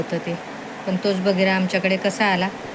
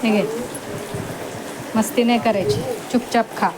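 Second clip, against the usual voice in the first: firm pet commands; 5 semitones higher than usual. Real, not acted. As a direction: tell the dog off kindly but firmly. Ordering the dog to eat.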